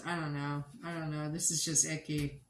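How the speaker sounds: ordinary talk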